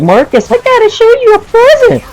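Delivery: extremely high, squealing creature voice; exaggerated falsetto imitation of a kid; high-pitched creature. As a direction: squeal it like some little creature. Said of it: "a high-pitched voice, like if he's, like, some kind of creature".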